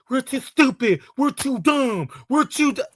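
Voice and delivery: lightly hammed-up mock whine; mocking quote. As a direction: mimic the complaint sarcastically. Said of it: "Every fucking time, like".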